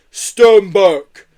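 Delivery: loud, high, drawn-out command; big creature readying to charge; bold yeti warning. A narrator's reading. Yeti about to charge a door.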